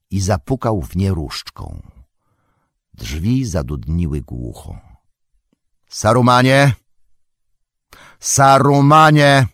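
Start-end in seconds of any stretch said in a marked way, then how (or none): 5.9-9.5 calling out loud and commanding, the second call stretched slow. A narrator's reading. Wizard calls Saruman out of his tower, loud and commanding. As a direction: summon him with authority. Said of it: "krzyknął Gandalf głośno i rozkazująco".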